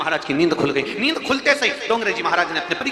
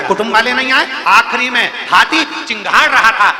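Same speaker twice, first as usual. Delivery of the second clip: loud, higher fervent sermon voice; full-force preaching; 5 semitones higher, 6 dB louder than usual. Acted. Preacher exhorting the congregation on true devotion, fervently. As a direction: preach it out to the whole crowd.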